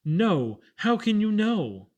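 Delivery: put-on a boy crying out in disbelief to the angel. A narrator's reading. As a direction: protest in disbelief. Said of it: "cried Freckles".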